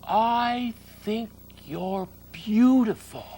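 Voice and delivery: high, slow piglet voice; small earnest pig; young pig voice. Acted.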